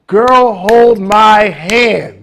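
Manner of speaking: very slow, tender reassurance; comforting plea. Retold or imitated. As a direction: reach out to a scared friend. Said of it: "it's comforting to say".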